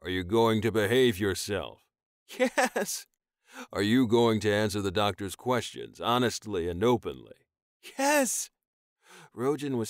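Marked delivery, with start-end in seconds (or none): 0.0-1.8 firm, louder demand; stern commanding. Acted. Rojan demanding. 2.3-3.1 high, sharp cry of yes; desperate yes. Acted. Tyon answering. 3.7-7.5 firm, louder demand; stern commanding. Acted. Rojan demanding. 8.0-8.5 high, sharp cry of yes; desperate yes. Acted. Tyon answering.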